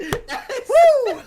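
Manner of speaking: high laughing cheer; excited whoop. Real, not acted. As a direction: whoop with delight. Cheering a natural twenty.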